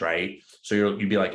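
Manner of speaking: normal talking voice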